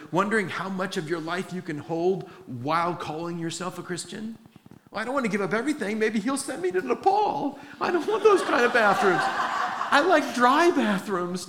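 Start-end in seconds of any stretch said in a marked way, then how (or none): 4.9-11.5 high, fast mock complaining, laughing; mock whiny believer. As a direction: parody a believer dodging the mission field. Mocks a reluctant believer's excuses.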